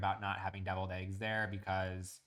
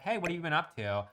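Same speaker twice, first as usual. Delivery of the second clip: upbeat higher friendly hello, quick; friendly greeting; 7 semitones higher, faster than usual. Retold or imitated. Picnic guest greeting you, chirpy and friendly. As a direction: greet an old acquaintance brightly. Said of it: "And they're like".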